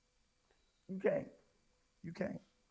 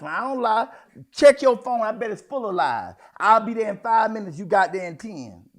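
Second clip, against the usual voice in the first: mock defensive liar; 8 dB louder than usual. A liar scolded in church, loud and indignant. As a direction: insist you don't lie, louder and louder. Acting a lying texter.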